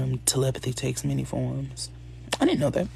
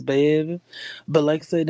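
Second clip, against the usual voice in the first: usual speaking voice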